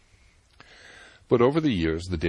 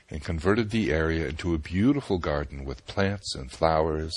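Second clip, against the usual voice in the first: usual speaking voice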